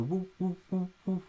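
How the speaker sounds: high pulsing woo woo, mimicking tremolo; imitating pulsing synth. Shown as a demonstration. Reviewer showing viewers the tremolo, high pulsing woos. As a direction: sing the throbbing synth pulse in quick high woos. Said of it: "the tremolo on the synth, the pulsing in and out".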